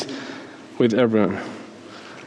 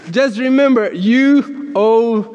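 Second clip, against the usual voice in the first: higher, slowing into a pointed reminder; gentle but forceful reminder; 7 semitones higher than usual. Retold or imitated. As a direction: drag out a playful, insistent reminder. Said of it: "this gentle but forceful reminder".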